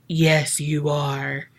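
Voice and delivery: a low, demonic "Yes, you are". Retold or imitated. The possessed wife answering the praying husband in a low voice. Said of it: "in a very low voice".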